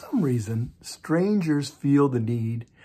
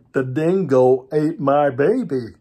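louder, slow, deadpan menace; mock menacing line; 7 dB louder, slower than usual. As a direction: deliver it slow and loud to scare strangers off. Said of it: "I'm going to say".

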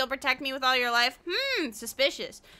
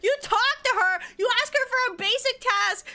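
exasperated rant; 7 semitones higher than usual. Real, not acted. Streamer ranting at the game character, high and exasperated. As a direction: vent at the game character's bluntness. Ranting at NPC's rude answer.

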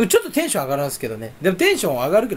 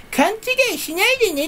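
high, quick, cutesy put-on voice; mock coy voice. Shown as a demonstration. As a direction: play a coy denial, hammed up. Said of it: "って言ってるわけじゃないんですよ". (高い作り声でツンデレ風に)

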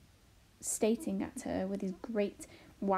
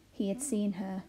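ordinary delivery, nothing marked

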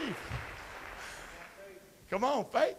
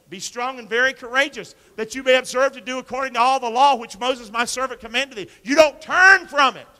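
loud, high preacher's call; preaching at full force; 6 semitones higher, 10 dB louder than usual. Acted. Pastor urging the congregation to be courageous, loud. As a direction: shout the promise over the room.

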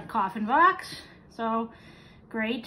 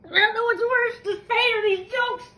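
crying in pain; 8 semitones higher, 4 dB louder than usual. Real, not acted. Pain after eating hot chip.

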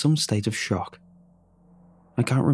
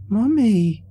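put-on high, loud little girl's insistent cry; a small child refusing to be pulled away; 12 semitones higher, 5 dB louder than usual. A narrator's reading. Little girl insists her mother is in the bathtub, high and loud. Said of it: "she insisted".

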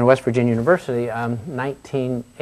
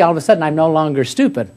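ordinary delivery

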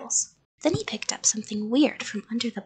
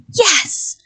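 high, loud frightened yell; screamed; 9 semitones higher, 8 dB louder than usual. A narrator's reading. Frightened child to the creature, screamed. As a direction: scream out the answer. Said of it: "I screamed".

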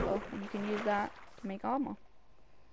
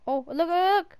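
excited; 8 semitones higher, 11 dB louder than usual. Real, not acted. Excited at game sight.

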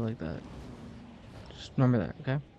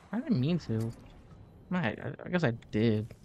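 ordinary delivery

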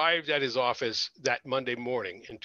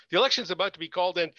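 rushed, cut off; 4 semitones higher, 5 dB louder, much faster than usual. Acted. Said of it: "he cut her off".

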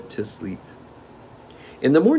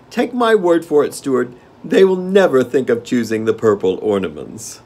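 gnome king; 9 semitones higher, 8 dB louder than usual. Acted. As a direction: relish the game like a spoiled monarch.

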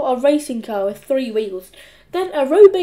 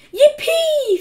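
very high, louder whoop; gleeful cheer; 6 dB louder than usual. A narrator's reading. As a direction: cheer your new fortune. Henry rejoices at his riches.

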